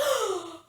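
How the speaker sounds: a short shocked intake of breath; shocked gasp. Shown as a demonstration. Presenter showing kids a shocked crowd gasp. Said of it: "They might gasp like this".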